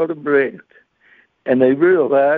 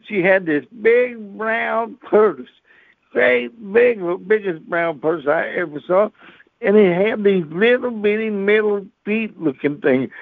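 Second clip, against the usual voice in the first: ordinary talk, nothing marked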